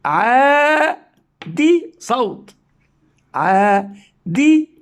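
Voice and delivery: عادي drawn out very slowly; slow syllable reading. Shown as a demonstration. Teacher sounding out عادي for children, slowly. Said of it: "وهنا أنطق".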